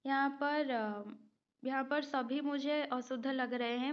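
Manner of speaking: ordinary delivery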